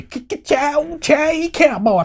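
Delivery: high, stretched-out cowboy whoop; cowboy catchphrase. Pretend. Host introducing the John Wayne movie, cowboy whoop.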